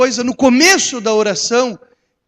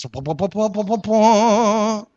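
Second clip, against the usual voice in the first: slow, voiced trumpet blasts; mock trumpet fanfare; slower than usual. Pastor imitating trumpets after a showy prayer, mock fanfare. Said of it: "retumbem as trombetas".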